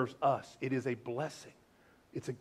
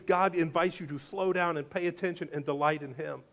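ordinary talk

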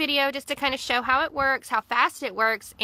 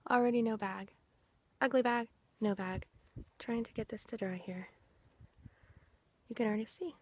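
ordinary delivery

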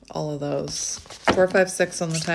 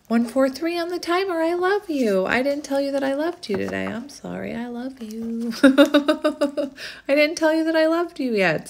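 high cooing; 7 semitones higher than usual. Real, not acted. Cooing love at someone off camera.

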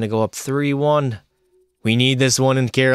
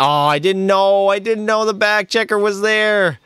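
surprised excuse; 8 semitones higher than usual. Real, not acted. Surprised excuse mid-play.

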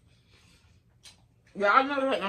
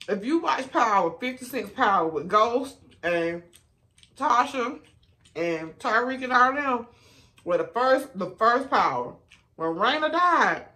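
excited; 5 semitones higher, 5 dB louder than usual. Real, not acted. Building up to crying at Power.

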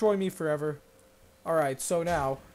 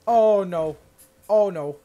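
Oh no yelled much louder in hammed-up dread; loud mock dread; 2 semitones higher, 10 dB louder, slower than usual. Gamer dreading the opponent's attack, loud mock wail. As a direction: wail Oh no as the hit comes.

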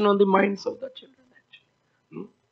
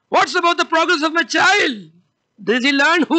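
a lightly voiced stern father at the meeting; demanding king; 6 semitones higher than usual. Hiranyakashipu asking teachers about his son, higher and demanding. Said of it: "Hiranyakashipu is a parent".

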